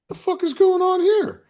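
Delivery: high, loud cry of disbelief; shocked disbelief. Retold or imitated. Bank customer stunned as a gunman walks in, high loud outburst. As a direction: blurt it out, stunned.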